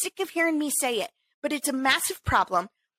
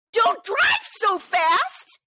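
higher, slow, sneering echo; mocking repeat; 10 semitones higher, much slower than usual. Mocking the driver who pulled out, slow sneering echo. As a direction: parrot him back with scorn.

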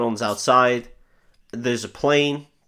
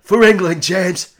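much higher and louder, mock heroic; hammed-up toast; 8 semitones higher, 8 dB louder, slower than usual. Mocking Trevelyan's line to Bond, hammed-up heroic. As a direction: overblown mock salute. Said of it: "And he's like".

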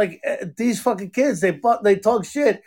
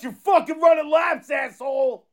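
mock coach bellowing, much higher; coach yelling; 10 semitones higher than usual. Imagining himself as coach, shouting at a mouthy kid. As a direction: yell it like a fed-up youth coach.

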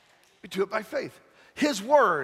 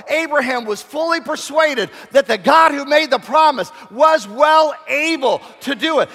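ordinary talk

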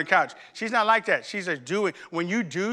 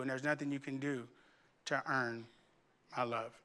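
very soft, low, halting; tender assurance; 5 semitones lower, 14 dB quieter than usual. Acted. God assures believer love cannot be earned, tenderly. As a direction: drop right down and space it out gently.

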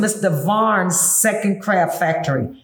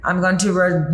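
ordinary talk, nothing marked

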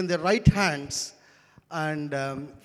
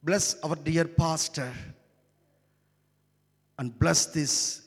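solemn prayer; 3 semitones lower than usual. Acted. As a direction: pray it reverently, letting each phrase settle. Leads congregation in prayer.

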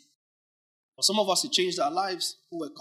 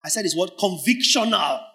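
usual speaking voice

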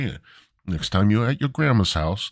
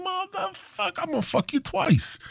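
mock excited; 3 dB quieter than usual. Excited promise to hookup.

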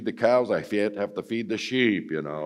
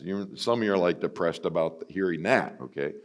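usual speaking voice

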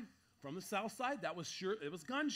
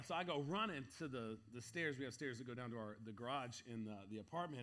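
ordinary talk

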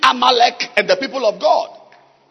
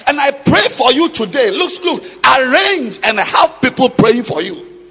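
raised, loud prayer declaration; full-force preaching; 4 semitones higher, 4 dB louder than usual. Real, not acted. Preacher praying for people to get victories, loud and impassioned. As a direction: proclaim the blessing over the crowd. Preacher prays over congregation.

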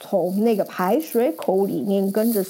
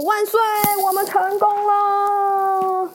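higher, slow triumphant cheer; 9 semitones higher, 3 dB louder, slower than usual. Acted. Knight and ferrets cheer their victory, high and joyful. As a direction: celebrate the win loudly. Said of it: "一起欢呼".

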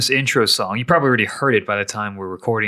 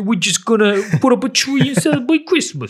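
put-on hammy, high Brooklyn accent; 7 semitones higher than usual. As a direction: do a goofy New Yorker. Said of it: "sounds like Jim Gaffigan doing a Brooklyn accent".

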